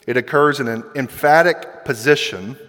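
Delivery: ordinary talk, nothing marked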